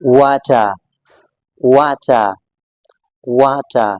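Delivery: water with a clear t and silent r, "wah-tah"; British pronunciation. Shown as a demonstration. Teacher models British water for learners, British pronunciation. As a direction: open "ah" ending on water, no r. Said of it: "British English में completely different pronounce".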